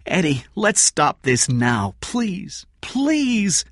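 pleading, pitch climbing high on please; begging. Acted. Kathy begs Eddie to stop, pleading high. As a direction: beg him to stop, voice rising.

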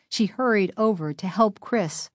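ordinary delivery

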